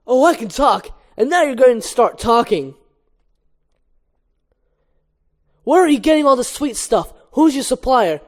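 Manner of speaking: loud, high, pressing demand; aggressive interrogation. Acted. Barry interrogates Hector about his supplier, aggressively. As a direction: grill the suspect hard. Acted interrogation at stinger point.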